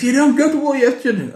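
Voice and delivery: high laughing voice. Real, not acted. Laughing at own cowardice.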